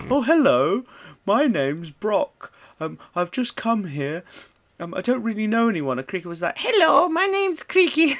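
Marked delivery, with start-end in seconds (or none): 0.1-6.0 high, eager newcomer voice; new guy voice. Retold or imitated. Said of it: "he said". 6.6-8.1 much higher, squeaky bad impression; a hammed-up squeaky character voice; Cricket impression. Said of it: "That was not a very good Cricket impression".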